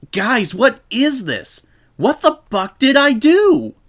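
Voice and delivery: high, loud, desperate yell; frantic outburst. A narrator's reading. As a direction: explode at your friends in panic. Rainbow's outburst at her friends.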